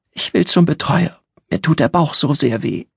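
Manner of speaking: put-on high child voice, pleading; a hungry little girl asking her roommate; childlike and plaintive; small girl voice. A narrator's reading. Christina in pain.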